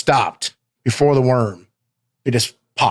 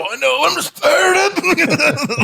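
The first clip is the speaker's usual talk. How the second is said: breathless strained shout, much higher and slow; fired up; much slower than usual. Retold or imitated. Wrestler answers the referee mid-match, breathless fired-up yell. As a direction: yell it out, overpumped. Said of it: "I fire up ridiculously where I couldn't breathe".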